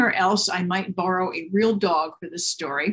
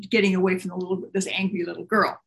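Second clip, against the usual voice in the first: plain reading voice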